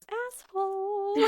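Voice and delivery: high, drawn-out sing-out; mock calling out. [name] walking away from Mitchell, mock calling out. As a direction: toss it back in a long high call.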